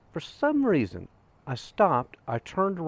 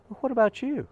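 retold question, lifted much higher; turning the question back; 13 semitones higher, 3 dB louder than usual. Man asks the grieving hiker about herself, turning the question back. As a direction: swing the pitch high to hand the question over.

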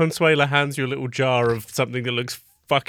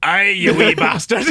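louder, higher hammed-up Scottish accent; mock Scottish toy; 5 semitones higher, 6 dB louder than usual. Game master playing a Fisher-Price cow, loud Scottish accent. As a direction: belt it out as a Scots talking toy. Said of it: "The cow says".

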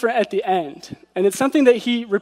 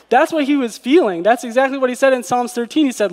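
usual speaking voice

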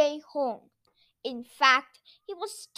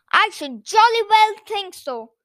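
loud, higher indignant retort; angry shout; 6 semitones higher, 10 dB louder than usual. A narrator's reading. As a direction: shout crossly at the culprits. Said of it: "Noddy shouted at them angrily".

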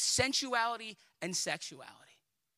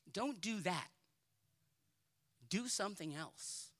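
ordinary talk

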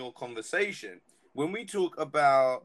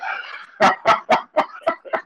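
loud high-pitched laugh burst; high squealing laugh; 14 dB louder than usual. Real, not acted. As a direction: shriek with laughter. Laughing hard at story.

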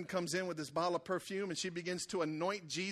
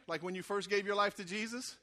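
ordinary talk

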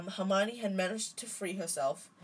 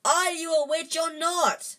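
loud, higher exasperated shout; bellowing; 11 semitones higher, 8 dB louder than usual. A narrator's reading. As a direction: yell at her to use magic. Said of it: "Ron bellowed".

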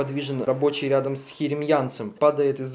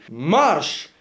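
barked order; 3 semitones higher, 4 dB louder than usual. Acted. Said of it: "выкрик".

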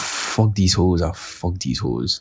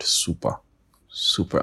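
disdainful repeat; 4 dB quieter, slower than usual. Shown as a demonstration. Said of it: "I hate that expression".

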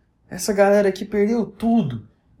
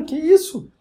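high, louder burst of disbelief; shocked disbelief; 11 semitones higher, 4 dB louder, slower than usual. Retold or imitated. Vlogger hit by yet more bad news, high shocked disbelief. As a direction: react like it's one blow too many.

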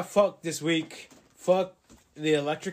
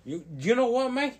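put-on higher Australian-style mate accent; 9 semitones higher, 3 dB louder than usual. Host re-enacting earlier accent joke, put-on accent. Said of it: "we were talking in accents".

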